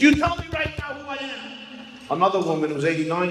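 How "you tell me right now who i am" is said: much higher, outraged insistence; outraged demand. Retold or imitated. Trump furious the old woman doesn't know him, outraged. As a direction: demand it, outraged and shrill with offence. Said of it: "He is now outraged".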